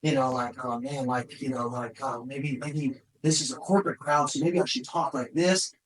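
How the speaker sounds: nervous speaker before a corporate crowd, timid quiet voice; nervous hushed inner voice. Retold or imitated. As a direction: murmur it like you're scared before going on stage. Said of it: "I'm like".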